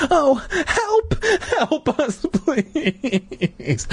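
high falsetto begging; hammed-up whimpering squeal; desperate plea. A narrator's reading. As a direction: wail for help, stretching it out. Trapped children begging for help.

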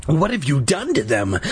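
usual speaking voice

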